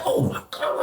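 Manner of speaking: soft unvoiced whisper; whispered aside. Realizing he has cash, whispering to himself. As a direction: breathe it out under your breath. Quietly reacting as he remembers.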